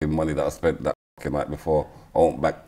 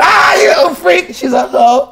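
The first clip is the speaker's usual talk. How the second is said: much higher, loud gleeful yell; delighted shout; 10 dB louder than usual. Real, not acted. Catching his date out with a high, loud, gleeful yell. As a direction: pounce on her confession with glee. Reacts to her picture confession.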